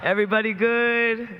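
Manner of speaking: loud, high crowd greeting; rally speech. Real, not acted.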